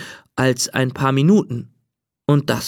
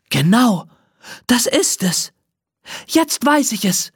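higher, eager realization; excited call; 7 semitones higher than usual. A narrator's reading. Mermaid suddenly knows her wish, excited call. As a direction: burst out with the idea. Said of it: "ruft sie".

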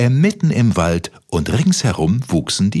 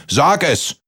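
bellowing giant; 9 semitones higher than usual. Acted. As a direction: order him to speak. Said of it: "brüllte der Riese".

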